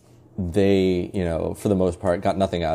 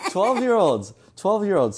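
much higher, louder, cracking up; laughing disbelief; 13 semitones higher, 6 dB louder, slower than usual. Real, not acted. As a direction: exclaim it through real laughter, pitched way up. Laughing at own savage anecdote.

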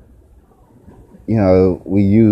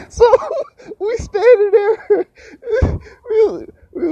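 words squeezed out through high laughter; can barely get it out for laughing. Real, not acted. Telling his brother's mom story while cracking up, laughing.

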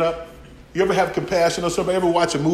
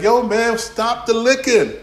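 annoyed owner; 7 semitones higher, slower than usual. Retold or imitated. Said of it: "You're like".

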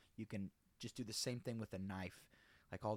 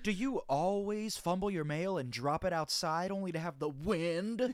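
infomercial; 7 semitones higher, 8 dB louder than usual. Pretend. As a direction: pitch a silly problem dramatically. Parody infomercial about mail.

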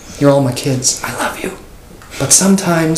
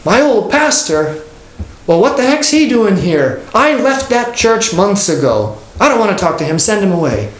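indignant Carl; 7 semitones higher than usual. Retold or imitated. Man refusing to see his old pastor, high and indignant. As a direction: play the sulking member refusing visitors. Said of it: "And he said".

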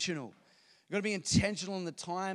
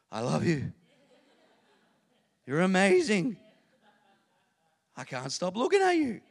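doting father; 7 dB louder than usual. Retold or imitated. Voicing himself with newborn daughter.

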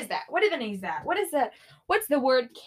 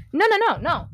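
higher and louder, rapid refusal; emphatic no; 6 semitones higher, 6 dB louder than usual. Real, not acted. Vlogger refusing outright, loud and high. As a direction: cut it off loudly.

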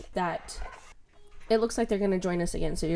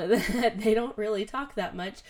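usual speaking voice

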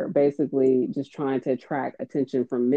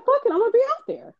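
defiant; 3 dB louder, much faster than usual. Retold or imitated. Defiant retold inner decision.

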